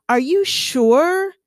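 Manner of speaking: "sure" drawn out as "shoo-er", rising; childhood accent. Retold or imitated. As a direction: ask it slowly with a long "oo" in "sure". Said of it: "I had to get rid of my accent".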